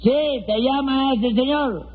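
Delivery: high, insistent call; high-voiced relay of a summons; calling to the deaf. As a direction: pitch it up so the deaf man hears. Repeating for a deaf man.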